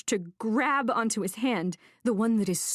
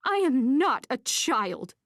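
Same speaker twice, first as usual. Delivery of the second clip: angry insistence; 6 semitones higher, 4 dB louder, slower than usual. A narrator's reading. End of her outburst.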